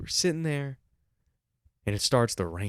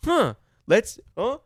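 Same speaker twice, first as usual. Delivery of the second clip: mock bossy manager, high and loud; bitchy, controlling; 13 semitones higher, 9 dB louder than usual. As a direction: exaggerate a controlling woman's pointed remark. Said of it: "controlling tone".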